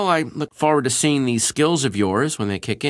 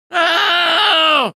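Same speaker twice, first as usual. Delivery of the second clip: shout; 7 dB louder than usual. Acted. Startled cry.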